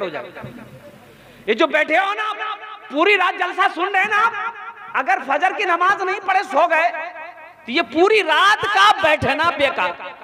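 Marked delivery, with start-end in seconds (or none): 1.5-10.2 higher, urgent pulpit exhortation; fervent high-pitched sermon call; impassioned preaching. Acted. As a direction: drive the warning home from the pulpit. Preaching at full force.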